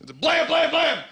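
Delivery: high yelped blam blam blam; mock gunfire. Gunman on Parliament Hill firing, mock yelled blams. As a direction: bark out blam blam blam like a toy gun. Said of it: "opening up in the Parliament building saying".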